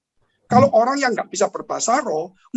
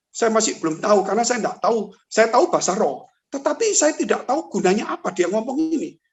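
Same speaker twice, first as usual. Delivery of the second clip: ordinary delivery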